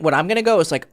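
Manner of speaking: ordinary talk, nothing marked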